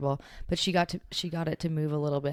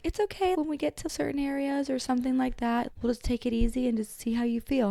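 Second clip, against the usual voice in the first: lightly voiced chiropractor, higher; a hint of the gentle chiropractor, pitched up; kind chiropractor; 7 semitones higher than usual.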